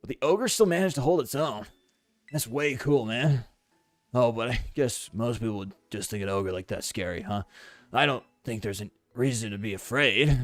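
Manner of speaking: put-on surfer-like character voice; Kieran. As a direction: play a game character musing about an ogre. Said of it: "You make him sound like a surfer".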